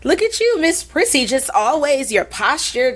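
high, sing-song teasing voice; mock teasing classmates. Classmates teasing a ballet girl, high mocking voice. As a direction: mimic the high school kids mocking her posture.

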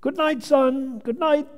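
high-pitched holler up the stairs. Retold or imitated. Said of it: "would holler up the stairs".

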